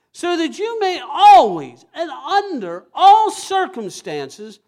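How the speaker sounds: high, loud, rising preacher's cry; preaching at full force. Acted. Pastor exhorts congregation about grace, loud impassioned preaching. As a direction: drive the promise home to the crowd.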